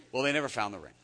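usual speaking voice

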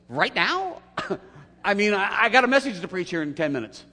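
high, loud disbelieving whine; incredulous protest. Retold or imitated. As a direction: squeal it out like you can't believe it. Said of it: "it would have sounded like this".

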